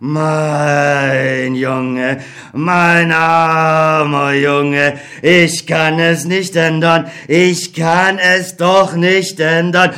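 sorrowful, can do nothing; helpless old God. Acted. God apologises to Beckmann, slow and grieving.

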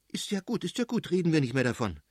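hurried, high-pitched appeasement; anxious to drop the subject; damping him down. A narrator's reading. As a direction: hush an angry spouse quickly. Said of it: "dämpfte ihn die Fürstin".

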